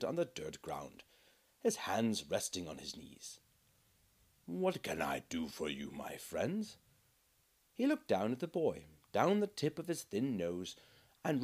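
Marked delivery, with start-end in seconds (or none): 4.5-6.8 lower, softer kindly friar; soft voice of a humble brother; gentle monk. Acted. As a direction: kneel down and speak gently.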